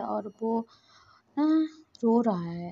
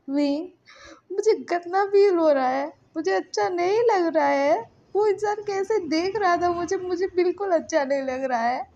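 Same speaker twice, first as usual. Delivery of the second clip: high, louder, distressed pleading; tearful; 8 semitones higher, 4 dB louder than usual. A narrator's reading. Lanson complains to Wing that he feels dirty, tearful distress. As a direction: pour it out upset, pitch raised. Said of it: "बोल के ना वो रो रहा था".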